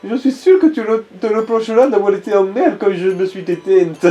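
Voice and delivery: high, tender dying wife; Scarlett's voice. Acted. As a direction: read it as a loving wife's farewell. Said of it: "La lettre que Scarlett a laissée".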